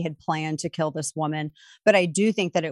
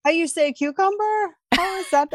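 a light fake Spanish accent, pitched much higher; mock Spanish accent; 10 semitones higher than usual. Cohost mocking Hilaria's fake Spanish accent, lightly. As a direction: tease her with a hammed-up Spanish accent. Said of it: "her fake Spanish accent".

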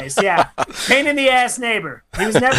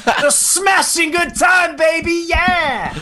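slow, drawn-out hype; hammed-up cheer; 7 semitones higher, much slower than usual. Real, not acted. Slow hammed-up cheer about the noisy neighbors. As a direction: ham it up like a lounge singer.

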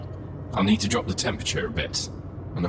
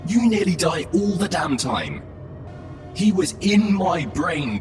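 shouting, angry; 6 semitones higher, 3 dB louder than usual. Acted. AI shouting in argument.

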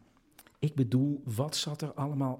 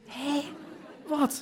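much higher, startled double take; startled "what?" in pitched-up shock; shocked disbelief; 9 semitones higher than usual. Acted. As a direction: jolt up in alarm at the news. Son shocked by drug news.

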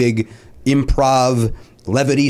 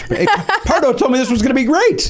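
mock bad-mouthing heckle; 12 semitones higher than usual. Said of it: "you're also welcome to, uh, bad mouthing on stage".

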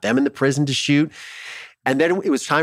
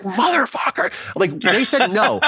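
high, gleeful swear of triumph; jubilant outburst; 10 semitones higher, slower than usual. Retold or imitated. Exclaiming to himself when told he can film, triumphant. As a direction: burst out in triumph, pitched way up. Joyful outburst at the good news.

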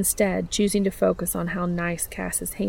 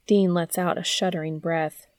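plain reading voice

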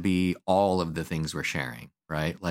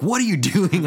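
pitched way up and louder, accusing; indignant high squeal; 11 semitones higher, 5 dB louder than usual. Retold or imitated. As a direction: mimic a kid's outraged demand. Client accusing, retold exchange.

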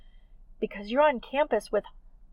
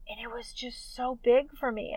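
ordinary talk, nothing marked

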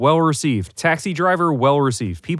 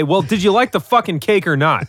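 heated rant; 7 semitones higher, 3 dB louder than usual. Real, not acted. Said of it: "do you need to cool down?".

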